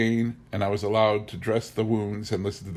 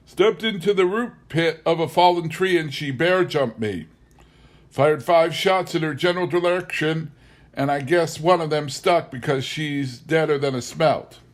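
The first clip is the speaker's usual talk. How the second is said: higher, louder boastful backwoods voice; proud storytelling husband; 7 semitones higher, 4 dB louder than usual. Acted. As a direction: brag about the fight cheerfully. Bob recounts the bear attack.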